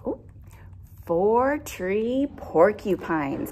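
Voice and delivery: ordinary delivery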